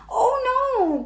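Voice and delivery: crying out. A narrator's reading. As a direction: yelp it like a kid who forgot again. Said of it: "cried Froggy".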